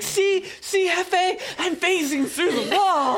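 high, frantic crazy-person voice; wild unhinged ranting. Pretend. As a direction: rave at him like a madman. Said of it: "acting like a crazy person and rubbing the wall".

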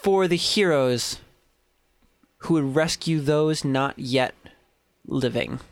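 slow, solemn inscription read. Acted. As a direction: intone the words on the box. Reads the box inscription.

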